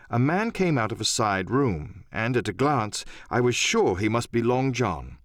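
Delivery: ordinary delivery, nothing marked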